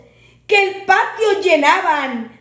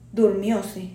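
ordinary delivery, nothing marked

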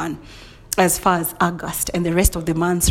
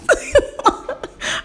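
high breathless cackle; lunatic laugh. Real, not acted. Showing how she goes zero to lunatic, wild high laugh. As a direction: laugh like you've lost it. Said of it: "from zero to lunatic".

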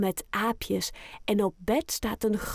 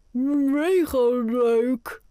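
very slow and louder, words through foam; mouth full of toothpaste; 4 semitones higher, 6 dB louder, much slower than usual. Acted. Boy brushing teeth answers grandma, mouth full of foam. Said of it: "met zijn mond vol schuim van de tandpasta".

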